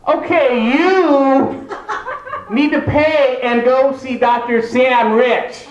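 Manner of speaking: high, slow, mock-careful doctor. Doctor telling the hard-of-hearing comedian the result, high and slow. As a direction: spell it out to a deaf patient. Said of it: "he's like".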